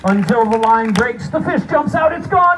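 high, loud mock anguish; dramatic despair. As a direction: sell the loss big. Fish escapes, mock despair.

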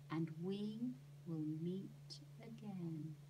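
slow, breathy whispered fortune teller; whispered prophecy. Acted. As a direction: hush it out like a secret. Fortune teller's last words.

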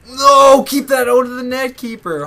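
high, loud panicked yell at the keeper; panicked shout. Real, not acted. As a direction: scream at the screen to save it. Shouting at his goalkeeper in game.

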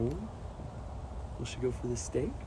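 ordinary talk

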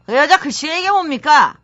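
exasperated boss picking faults; nagging boss. Acted. Boss criticising Miss Ji's handwriting, nagging. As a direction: scold the clerk with theatrical exasperation.

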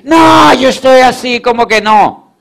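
playing a scared upline who yells no; mock shouted refusal. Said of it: "¿qué te va a decir?".

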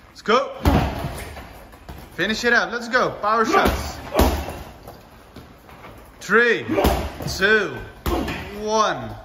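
loud, high coaching yells; coach shouting. Real, not acted. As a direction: push them through the last seconds. Coach pushing final seconds.

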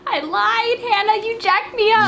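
mock crying. Admits cookie burned her.

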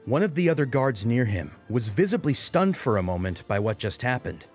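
ordinary delivery, nothing marked

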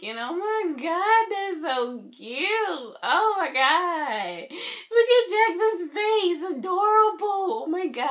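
high, loud fangirl squeal drawn out slowly; squealing with excitement. Real, not acted. Fan seeing the group photo for the first time, squealing excitedly. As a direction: gush over the photo like a starstruck fan.

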